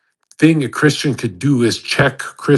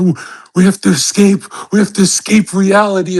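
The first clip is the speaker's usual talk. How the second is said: higher and louder, mocking a doom-laden young influencer; mocking quote; 7 semitones higher, 5 dB louder than usual. Mocking Sneako's talk to his viewers, in a raised, louder mock voice. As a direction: parody a dramatic escapist rant. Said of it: "If I see a human speaking, like".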